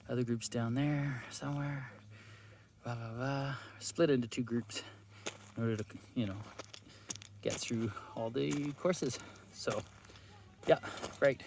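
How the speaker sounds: usual speaking voice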